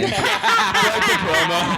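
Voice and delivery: high slow laughing. Real, not acted. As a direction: squeak it out laughing. Laughing at own idea.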